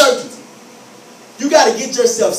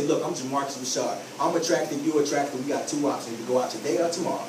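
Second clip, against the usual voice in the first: certain; 9 semitones lower, 9 dB quieter than usual. Shown as a demonstration. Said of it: "and I'm certain".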